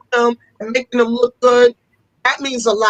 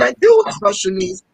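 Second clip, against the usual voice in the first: excited reply; 9 semitones higher than usual. Retold or imitated. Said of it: "I said".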